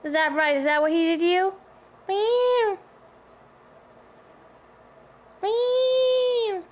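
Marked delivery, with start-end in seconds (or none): 2.1-6.6 high mock cat meows; imitated meow. Owner meowing to converse with her pet cat. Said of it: "Converse with my pet cat".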